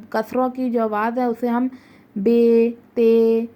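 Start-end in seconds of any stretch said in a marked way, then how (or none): 2.2-3.4 بے and تے with a thin, fine kasra; thin kasra vowel. Shown as a demonstration. Teacher shows how kasra is said in بے and تے. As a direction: say بے, تے short and fine. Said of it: "اس طرح ادا کرتے ہیں".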